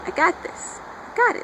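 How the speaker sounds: breathy then squeaky high excitement; rising to a squeal of I-got-this; giddy confidence. Retold or imitated. Diana assuring her friend she knows the gift, giddy squeal.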